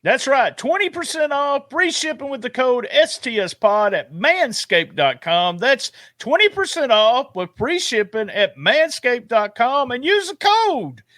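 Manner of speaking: punchy sponsor read. Acted. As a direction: sell the discount code like an announcer. Reads sponsor promo with code.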